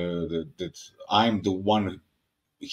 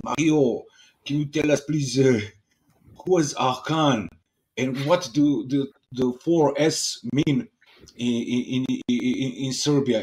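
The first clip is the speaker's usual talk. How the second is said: higher, louder imitated French accent; 4 semitones higher, 3 dB louder than usual. French friends asking Mario in a bar, French accent. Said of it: "I'm going to put some accent".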